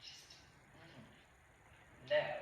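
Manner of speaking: haughty hushed whisper. Real, not acted. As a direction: drop to a snooty whisper.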